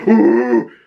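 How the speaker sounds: short, loud imitated roar; Punisher roar. Fan showing the warning sound before trouble, loud roar. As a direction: burst out with a mock roar. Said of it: "If you hear Jon Bernthal's Punisher go".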